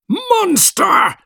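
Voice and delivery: very high-pitched scream of one word. A narrator's reading. Creator calls out at the monster, high-pitched scream. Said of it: "I screamed".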